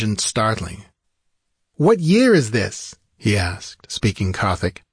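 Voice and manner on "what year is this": high, slow, wondering question; high-voiced dazed captive; stranger waking bewildered. A narrator's reading. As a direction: ask it high and drawn out, newly awakened. Freed stranger's first startled words.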